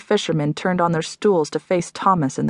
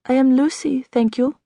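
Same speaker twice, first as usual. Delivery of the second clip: put-on higher, lightly French-accented young woman; French accent, stranger answering the doctor; 6 semitones higher than usual. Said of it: "What's that accent?".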